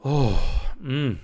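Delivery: a moved, rising "Oh, mm" as it hits her; overcome with feeling. Real, not acted. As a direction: let the feeling catch you mid-breath. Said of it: "I'm just feeling it again".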